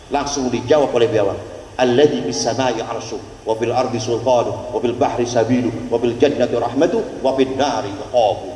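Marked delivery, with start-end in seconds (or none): 1.8-8.5 low, measured Arabic cadence; preached recitation. Retold or imitated. The lizard preaches its answer, low and measured. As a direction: recite it like a khutbah.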